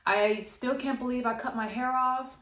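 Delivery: usual speaking voice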